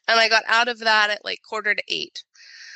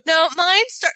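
ordinary talk, nothing marked

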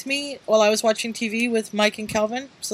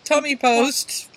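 higher, much louder sheepish oops; mock apology; 4 semitones higher, 7 dB louder, slower than usual. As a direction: sheepishly admit the mistake. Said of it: "you know, like".

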